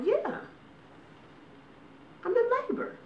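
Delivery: very high squeaky yeah I'm in labor; deadpan reply. Retold or imitated. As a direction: say it lightly, unbothered. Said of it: "And I go".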